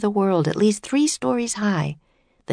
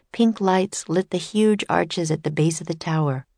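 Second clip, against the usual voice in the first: usual speaking voice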